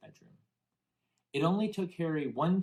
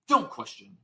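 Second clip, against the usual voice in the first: sharp, higher, louder snap; snapped order; 11 semitones higher, 7 dB louder than usual. A narrator's reading. Uncle snapping at Harry to stop asking. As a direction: bite off the order like an angry uncle. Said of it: "snapped his uncle".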